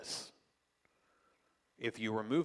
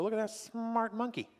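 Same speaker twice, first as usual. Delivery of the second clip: admiring onlooker; 11 semitones higher than usual. Retold or imitated. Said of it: "You just go".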